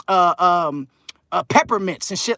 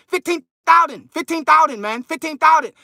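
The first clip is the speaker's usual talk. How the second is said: higher pitched, clipped imitation of a mad dentist with a Chinese accent; mock angry Chinese accent; 6 semitones higher than usual. Imitating the Chinese dentist haggling, mock Chinese accent. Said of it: "he was like a Chinaman ... they get mad real quick".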